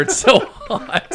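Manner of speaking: drawn-out words through laughter; cracking up. Real, not acted. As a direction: laugh helplessly as you finish the thought. Cracking up at own image.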